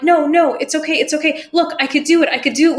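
put-on a five-year-old nervously reassuring the teacher; anxious child. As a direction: insist you can do it, anxious to please.

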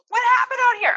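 high, sharp "What happened out here?"; the wrong, alarmed way to ask; reactive demand. Retold or imitated. Mom showing the reaction she regrets, sharp. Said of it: "I should have just calmly said".

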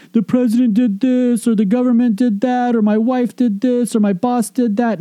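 mocking whiny blame. Mocking blaming excuses.

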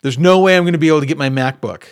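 imitating a frustrated teen, loud at the end; frustrated son. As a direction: play the son's angry complaint, rising into a loud outburst. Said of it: "he was angry and frustrated".

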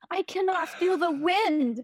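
high, slow mock-tearful delivery of feel; milk the cheesy line like a weepy soap star; melodramatic line reading. Retelling her first drama scene, hammed-up tearful recital. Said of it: "crying while reciting this line".

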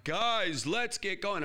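raising voice. Retold or imitated. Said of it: "I'm raising my voice".

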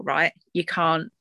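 normal talking voice

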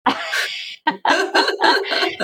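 a bright rising laugh at the memory; amused laugh, climbing up; high laugh. Real, not acted. Laughing at son's reply.

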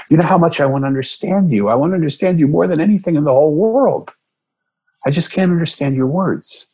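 father pleads with his daughter, voice rising; pleading. Retold or imitated. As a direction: beg her to be understood.

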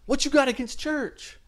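very high, indignant girlfriend imitation; mock pissed off. Girlfriend pissed off about church, high mock indignation. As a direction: challenge him in a put-on high voice. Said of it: "She gets all pissed off".

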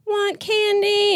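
put-on very high, slow whiny child asking; cranky toddler. A narrator's reading. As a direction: drag the words out like a tired toddler. Toddler begging at checkout.

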